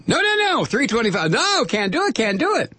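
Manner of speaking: high, squealing mock refusal; mock factory owner. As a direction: play a panicked boss refusing. Voicing slave labor organizers.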